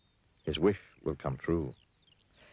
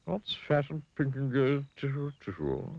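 worried pig; 9 semitones higher than usual. A narrator's reading. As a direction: repeat it uneasily as the pig. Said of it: "looking worried".

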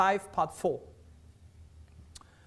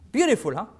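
ordinary delivery

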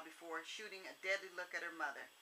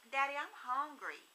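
put-on higher, small little girl; small child voice; 6 semitones higher than usual. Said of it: "Ebony's small voice said".

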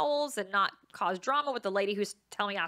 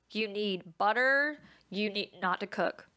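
ordinary delivery

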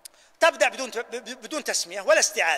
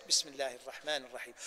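much softer, lower and almost to oneself; under the breath; 9 semitones lower, 13 dB quieter than usual. Shown as a demonstration. Teacher shows the basmala said silently to oneself. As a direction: say it quietly, just to yourself. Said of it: "بينك وبين نفسك".

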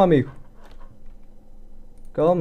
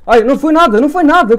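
excited relief; 10 semitones higher, 11 dB louder than usual. Real, not acted. Close call in the game.

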